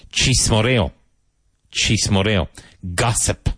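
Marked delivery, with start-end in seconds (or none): none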